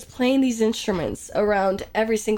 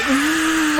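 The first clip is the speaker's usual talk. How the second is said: forceful, higher buzz; hard imitated buzzing; 4 semitones higher than usual. Streamer showing how hard the guide buzzed, forceful high buzz. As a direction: push the zzz out hard, exaggerating it. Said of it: "like, this hard".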